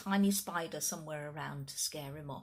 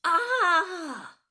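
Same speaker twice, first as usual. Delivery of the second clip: loud, high shriek of fright; sudden high scream from a terrified dinosaur; frightened scream; 11 semitones higher, 12 dB louder than usual. Acted. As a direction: shriek like a giant who just saw a spider. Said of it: "cried the Gigantosaurus".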